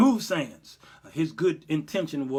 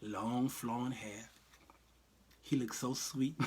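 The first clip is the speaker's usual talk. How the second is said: dreamy Jesus voice; 5 semitones lower, 10 dB quieter, slower than usual. Pretend. Mocking pretty movie Jesus.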